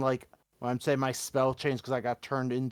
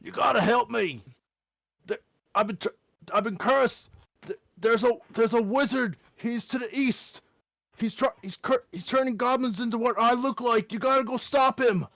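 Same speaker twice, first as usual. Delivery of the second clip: higher pleading goblin voice; 8 semitones higher than usual. Acted. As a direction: beg for help as a desperate goblin. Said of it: "try my best to mimic the goblin voice".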